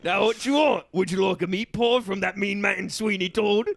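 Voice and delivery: put-on London street-seller patter; Cockney accent. Driver offering his passenger a pie, comic Cockney. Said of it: "I'm gonna do an accent".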